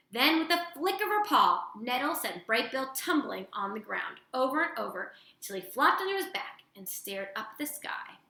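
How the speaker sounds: usual speaking voice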